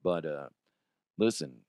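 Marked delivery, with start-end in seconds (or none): none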